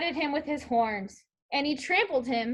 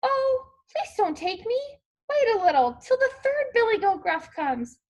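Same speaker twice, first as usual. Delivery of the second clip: higher pleading goat voice; not so small voice; 8 semitones higher than usual. A narrator's reading. Second goat begs the troll, in a not so small voice. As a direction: plead as a middle-sized goat. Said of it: "his voice was not so small".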